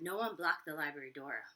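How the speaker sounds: ordinary delivery, nothing marked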